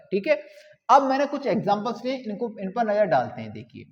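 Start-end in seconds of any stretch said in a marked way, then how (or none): none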